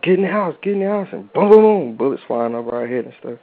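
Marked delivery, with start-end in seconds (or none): 0.0-1.1 higher-pitched imitation of a yelled warning; mock calling out, pitched up; urgent retold warning. Gang members warning kids playing outside, urgent retold call. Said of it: "gang members would run out. They'd be like". 1.1-2.0 loud, high vocal booms; imitated gunfire booms. Bullets flying over children's heads, imitated booms.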